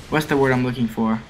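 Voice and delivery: ordinary talk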